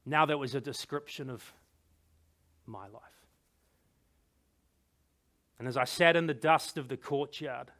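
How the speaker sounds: low, soft, with heavy pauses; desolate realisation. A narrator's reading. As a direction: let the loss sink in quietly. King realises his life is ruined.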